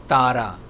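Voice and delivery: तारा with a flat dental त; तारा said without aspiration; plain Hindi T, wrong way. Shown as a demonstration. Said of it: "तारा नहीं है". (तारा सादे त के साथ)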